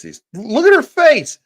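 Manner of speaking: high and loud, gawking in disbelief; excited outburst. Real, not acted. Streamer to chat about the reporter, loud and high with disbelief. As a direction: shout it out, unable to believe the face.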